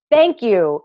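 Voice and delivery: normal talking voice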